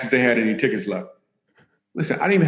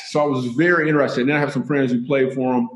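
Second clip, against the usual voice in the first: ordinary talk, nothing marked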